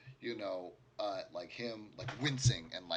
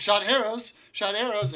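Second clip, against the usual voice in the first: very high, loud, panicked; frightened repeating; 12 semitones higher, 15 dB louder than usual. Acted. Survivor answers the player, very high and panicked. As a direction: blurt it twice in terror.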